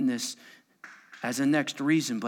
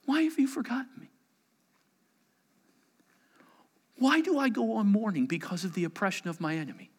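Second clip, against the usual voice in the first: pleading lament; 6 semitones higher, faster than usual. Acted. Psalm lament read.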